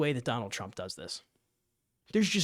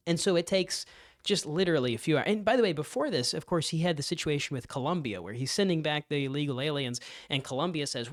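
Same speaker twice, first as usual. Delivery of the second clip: usual speaking voice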